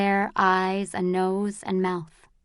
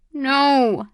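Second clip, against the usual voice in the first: through gritted teeth; 5 semitones higher, 5 dB louder than usual. Acted. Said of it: "through gritted teeth".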